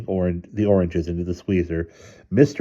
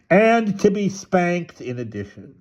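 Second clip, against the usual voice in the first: raised, higher-pitched scolding; stern father getting louder; 11 semitones higher than usual. A narrator's reading. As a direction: add the threat in a raised voice. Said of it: "he added in a loud voice".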